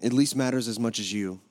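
low, hushed congregational prayer. Real, not acted. Pastor leading the congregation before the message, low quiet prayer. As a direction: pray softly and reverently, pitched low.

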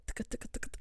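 rapid-fire percussive "tuk" syllables; imitating rap flow. Explaining to co-host how the rapper raps, imitated staccato flow. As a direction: mimic a stuttering rap rhythm with mouth noises. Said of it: "that's how he does it".

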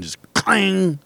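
a sharp high metallic clang; imitated clang. Recalling slammed house phones, high clanging noise.

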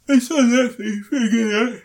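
words stretched out by a big yawn. Real, not acted. Tired podcaster yawning while recommending the film, yawning. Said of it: "You're yawning".

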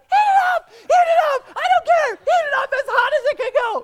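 hammed-up furious king shouting heat it up; king freaking out. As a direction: scream the order like a raging tyrant, cracking into a laugh. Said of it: "like, freaking out".

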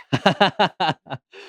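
embarrassed laugh. Real, not acted. Embarrassed at accent request.

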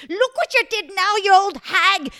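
high, very loud angry yell; furious shout. Acted. Margaret yelling at the old woman, furious and loud. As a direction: scream it at her in rage. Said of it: "Margaret got so mad".

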